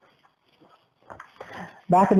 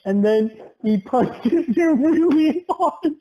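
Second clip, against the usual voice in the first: higher, giggly storytelling; laughing while telling; 4 semitones higher than usual. Real, not acted. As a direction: break into laughs mid-story.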